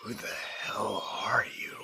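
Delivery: whispered under his breath; soft suspicious whisper; hushed whisper. Acted. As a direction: hiss it to himself, unseen. Muttering at a stranger.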